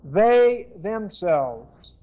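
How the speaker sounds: "They" held long and stressed, "themselves" slow after it; emphatic stress on They. Shown as a demonstration. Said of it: "something about believers emphatically".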